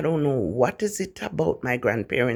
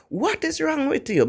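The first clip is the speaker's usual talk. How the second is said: grandfather woken suddenly, pitched much higher; startled grandfather; 13 semitones higher than usual. Retold or imitated. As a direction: jolt awake and demand an answer. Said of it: "and he'd be like".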